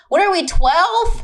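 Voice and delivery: high, slow, scornful disbelief; incredulous comeback. Retold or imitated. Woman retelling her comeback to his excuse, incredulous.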